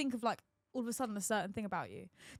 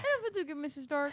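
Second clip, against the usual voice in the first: put-on high cute anime girl; 4 semitones higher than usual. Quoting Spider-Man line.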